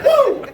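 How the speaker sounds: high, loud happy holler; excited woo; joyful whoop. Real, not acted. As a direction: whoop with delight. Celebrating after the group yell.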